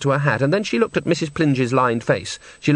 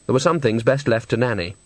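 ordinary delivery

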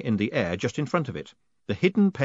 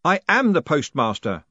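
shouted; 5 semitones higher, 5 dB louder than usual. Acted. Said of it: "Moist shouted".

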